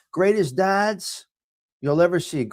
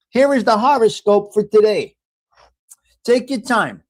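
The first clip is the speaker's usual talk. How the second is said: louder, announcer-style horoscope reading; 5 semitones higher, 6 dB louder than usual. Acted. Host reading the daily horoscope for viewers, announcer style. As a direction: read it out like a radio horoscope.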